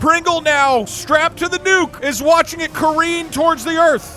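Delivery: game master's scene setting. Acted. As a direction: stretch out the spell's surprise.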